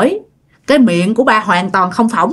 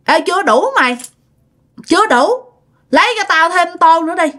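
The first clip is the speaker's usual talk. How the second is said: higher, rough commanding voice; barking for more like someone else inside him; possessed demand; 8 semitones higher than usual. Retold or imitated. Possessed father ordering his wife for more porridge, rough higher demand. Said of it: "ba mới kêu mẹ".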